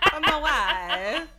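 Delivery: high, slow mock Borat accent, laughing. Riffing on the count's wife in a slow Borat accent. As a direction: stretch it out in a goofy Borat accent. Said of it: "From Italian to Borat real quick".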